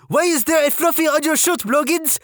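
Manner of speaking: high French-Canadian drill sergeant; French-Canadian accent. Retold or imitated. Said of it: "female French-Canadian sergeant with this accent".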